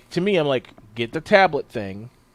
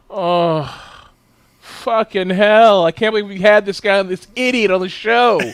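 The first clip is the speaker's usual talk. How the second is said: loud, laughing disbelief, stretched out on hell; exasperated outburst; 5 semitones higher, 7 dB louder than usual. Retold or imitated. Host retelling his reaction to guest, loud laughing disbelief. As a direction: blurt it out, half laughing at the idiot.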